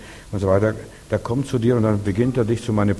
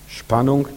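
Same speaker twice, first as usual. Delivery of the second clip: SPANNUNG pitched up with rising, suspended tune; tension; 4 semitones higher than usual. Shown as a demonstration. Preacher shows congregation what tension is, SPANNUNG raised and tense. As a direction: say SPANNUNG higher, left hanging. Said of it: "Merkt ihr das?".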